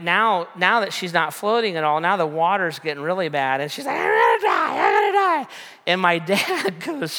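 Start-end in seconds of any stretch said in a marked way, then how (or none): 4.0-5.5 loud garbled mock wail; hammed-up hysterical shriek; freaking out. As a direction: imitate a panicked girl screaming in the water. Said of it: "kinda just freaking out".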